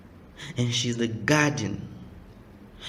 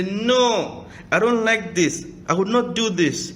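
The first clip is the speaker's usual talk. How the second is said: a loud, higher, forceful mock protest; angry refusal; 8 semitones higher, 4 dB louder than usual. A preacher acts out a husband banging the table, loud and angry. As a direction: slam it down like a man who won't budge.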